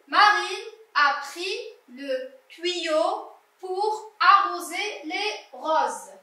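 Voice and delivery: slow, word-by-word reading; teacher's slow, spaced-out reading; slow model reading. Shown as a demonstration. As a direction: read it slowly for a beginning reader, pausing between words. Rereads so pupils can listen.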